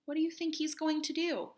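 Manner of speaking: ordinary delivery, nothing marked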